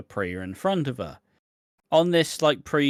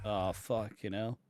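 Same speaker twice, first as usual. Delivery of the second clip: ordinary talk, nothing marked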